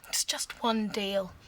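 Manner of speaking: angry whisper. Acted. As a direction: whisper it back, bitter. Hushed argument.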